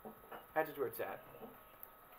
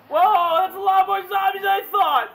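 high, very loud panicked yelling; panicked shout; 15 dB louder, slower than usual. Real, not acted. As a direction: scream at the zombie horde. Zombie horde surprise.

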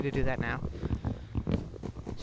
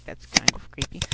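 ordinary talk, nothing marked

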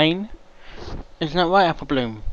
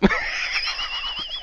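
short squeaky high laugh; 4 dB quieter than usual. Real, not acted. Reactor laughs at his own confession with a high squeak. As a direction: let out a squeaky high giggle.

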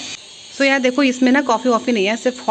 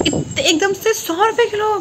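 high, slow disbelief at the price; shocked; 8 semitones higher, slower than usual. Real, not acted. Telling viewers the guava price, drawn out in high disbelief. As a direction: hit the price like it stings.

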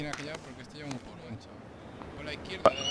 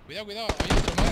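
higher, louder alarmed warning; urgent warning shout; 6 semitones higher, 12 dB louder than usual. Real, not acted. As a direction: shout the warning to your teammate. Aviso de otro enemigo. (grito de alarma, más agudo y fuerte)

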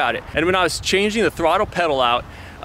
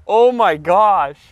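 slow amazed gasp; 4 semitones higher, 6 dB louder, much slower than usual. Real, not acted. Overwhelmed by the roof.

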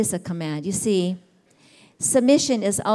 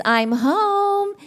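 calling out; 11 semitones higher than usual. Retold or imitated. Husband calling out on arrival.

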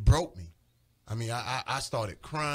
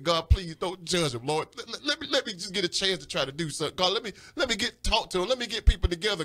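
pleading; 5 semitones higher than usual. Retold or imitated. Pleading with God in retelling.

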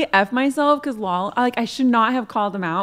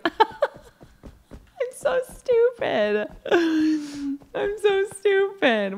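laugh-crying; 6 semitones higher, much slower than usual. Real, not acted. Laughing at own blunder.

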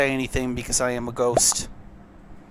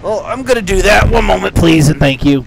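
loud announcement; 5 semitones higher, 10 dB louder than usual. Real, not acted. Announcing a break loudly.

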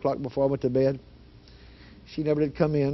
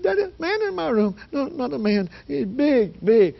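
high, panicky imitation, growing louder; scared daughter; 10 semitones higher, 3 dB louder, slower than usual. Frightened daughter describing the man to her father, high panicky voice. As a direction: blurt it in frightened bursts. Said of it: "never seen a human being that scared".

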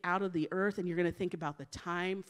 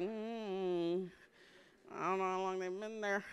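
doubtful, softer hum, then a wary remark; reluctant hesitation; 2 semitones lower, 6 dB quieter than usual. Acted. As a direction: hesitate over suspect food, voice dropped a little. Reluctant reaction to home leftovers.

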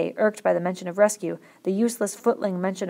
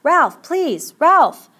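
high, loud pleading shout; 10 semitones higher, 10 dB louder, much slower than usual. A narrator's reading. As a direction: call desperately after someone. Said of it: "Piggy shouted".

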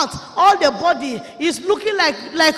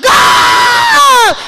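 a high, loud cry to God, far off from her usual voice; anguished cry; 11 dB louder than usual. Retold or imitated. Preacher reliving hell vision cries out loud. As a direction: wail it up at heaven.

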